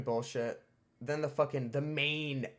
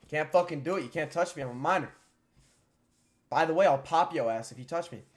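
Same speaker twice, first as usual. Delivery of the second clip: louder, higher, cocky pushback; defiant retort; 3 semitones higher, 7 dB louder than usual. Retold or imitated. Teen refusing school officers' search, loud and defiant.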